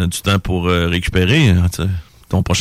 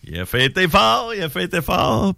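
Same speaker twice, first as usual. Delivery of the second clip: high, drawn-out teasing line; teasing exclamation; 8 semitones higher, 3 dB quieter than usual. Real, not acted. Host telling how hard a friend partied, teasing exclamation. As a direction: stretch it out high for effect.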